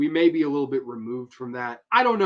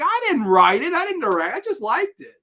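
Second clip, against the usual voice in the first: high defensive whine; 14 semitones higher, 6 dB louder than usual. Retold or imitated. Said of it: "I'll be like".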